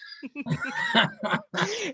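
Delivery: very high, wheezing giggle; high squealing laugh. Real, not acted. Reader laughing at her own joke, high squeal of laughter. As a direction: lose it at the punchline.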